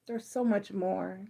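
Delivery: near-whispered echo of the urging; soft echo. Real, not acted. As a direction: let it sink in quietly. Soft repeat of previous line.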